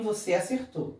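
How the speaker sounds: ordinary talk, nothing marked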